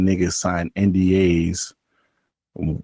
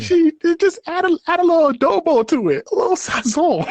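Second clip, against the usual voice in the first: playful chef. Pretend. Playful cooking joke, high voice.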